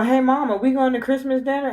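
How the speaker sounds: put-on high, quick child's question; voicing a child. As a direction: sound like an eager little boy. Voicing his son asking mother.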